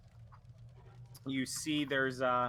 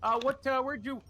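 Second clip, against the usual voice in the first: much higher, louder, chummy merchant voice; the game master voices the dockside shopkeeper greeting the adventurers, higher and louder; cheery shopkeeper; 11 semitones higher, 4 dB louder than usual. Acted. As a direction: greet them like a friendly dock trader. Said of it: "he turns to you and goes".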